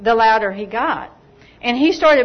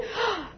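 quick startled gasp; sharp gasp; 8 semitones higher, 13 dB quieter than usual. Retold or imitated. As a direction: gasp in surprise. Jaw suddenly pops open.

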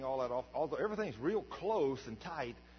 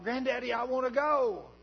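put-on higher, louder child calling out; hollering granddaughter; 10 semitones higher, 8 dB louder than usual. Granddaughter runs after granddaddy at the plane, hollering like an eager child. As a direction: call out like an eager little girl. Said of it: "She's hollering".

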